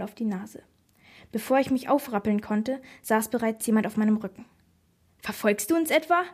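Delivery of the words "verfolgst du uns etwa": higher, mildly menacing question; sharp, narrated snarl; hissed threat. A narrator's reading. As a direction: challenge someone you've caught spying. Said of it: "fauchte Marinus ganz nah an meinem Ohr".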